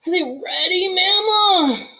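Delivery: put-on high, pleading little boy voice; a small boy begging at the oven; eager child. Little Buddy pleading with Mama at the oven.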